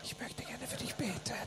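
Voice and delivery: very soft, secretive whisper; timid whisper. Retold or imitated. Interpreter as timid believer at mall, furtive whisper. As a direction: whisper it discreetly.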